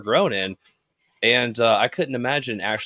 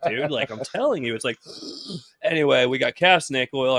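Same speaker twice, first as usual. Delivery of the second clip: ordinary talk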